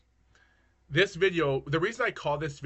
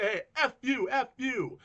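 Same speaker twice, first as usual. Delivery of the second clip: retold heckle; 9 semitones higher than usual. Retold insult across the street.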